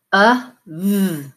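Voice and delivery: "Uh" then "v" split apart; segmenting demo; sound by sound. Shown as a demonstration. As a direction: break "of" into its two sounds. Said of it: "you're gonna say uh".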